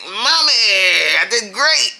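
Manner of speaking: put-on a kid showing off her good report; proud little girl. Daughter handing mom the school book after a good day, high proud voice. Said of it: "she was handing me the book like".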